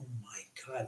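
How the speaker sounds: very soft, marvelling aside; hushed awe. Retold or imitated. Onlooker watches horses clear the jump, in hushed amazement. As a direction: drop right down in amazement.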